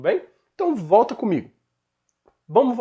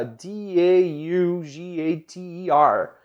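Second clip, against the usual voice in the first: letter by letter, slow and deliberate; spelled out slowly; much slower than usual. Shown as a demonstration. Teacher spelling out the word slowly for students. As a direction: spell each letter with a clear pause. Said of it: "A palavra que a gente acabou de citar".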